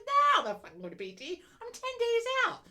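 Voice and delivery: high and loud, incredulous; retelling an exasperated reply, loud and high; exasperated retort. Retold or imitated. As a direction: shout back in disbelief. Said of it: "I'm like".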